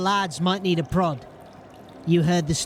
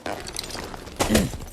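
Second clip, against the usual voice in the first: short throat clearing; 3 semitones higher, 4 dB quieter than usual. Acted. Dornish clears throat before offering help. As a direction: clear your throat to take the floor.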